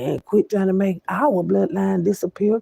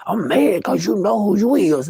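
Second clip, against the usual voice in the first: higher, louder mock of an offended critic; mocking imitation; 5 semitones higher, 4 dB louder than usual. Speaker imitates someone mad at being known, mocking. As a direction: play up someone else's indignation, high and loud.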